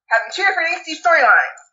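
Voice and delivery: ordinary talk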